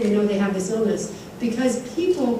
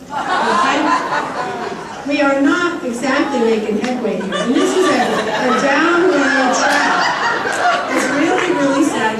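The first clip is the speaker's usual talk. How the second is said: laughing through the words, a little louder; half-laughing, rueful and incredulous; 4 semitones higher, 4 dB louder, slower than usual. Real, not acted. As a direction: laugh in disbelief at the numbers. Laughing at funding disparity.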